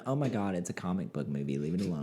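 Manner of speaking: soft, low mock whine; whiny fan. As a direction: mumble it, dismissive. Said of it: "like, going".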